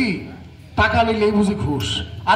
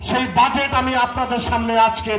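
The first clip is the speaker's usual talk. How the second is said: higher, formal public address; pompous mayor; 4 semitones higher than usual. Acted. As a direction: orate to the crowd like a self-important chairman. Chairman opens the budget speech.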